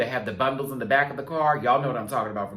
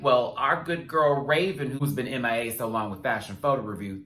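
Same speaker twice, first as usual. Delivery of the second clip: ordinary talk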